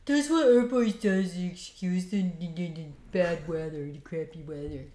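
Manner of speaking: a light imitation of his lecturing; imitating his voice. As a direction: mimic a friend's ranting tone, lightly. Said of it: "he said it in that voice".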